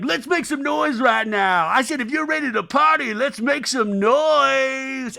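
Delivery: loud, high DJ hype shout; party DJ. Retold or imitated. DJ hypes the party crowd, shouted hype. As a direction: whip up the crowd, stretching the final word. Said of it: "The D J's like".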